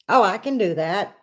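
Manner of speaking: ordinary talk, nothing marked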